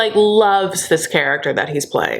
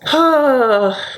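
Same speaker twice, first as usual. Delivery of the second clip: weary sigh; 5 semitones higher than usual. Real, not acted. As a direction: let out a big sigh before moving on. Sighs after mocking the couple.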